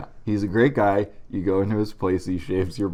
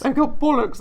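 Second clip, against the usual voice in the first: high, louder hammed-up British accent; mock British accent; 6 dB louder than usual. Friend finishes the joke in his fake British voice. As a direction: play a silly fake Brit. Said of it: "I gotta work on my British accent".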